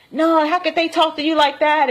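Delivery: high-pitched mock outrage; indignant. Leviathan on how they talk to her, indignant. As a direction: exclaim it high, as if scandalized. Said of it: "but he wasn't talking like that".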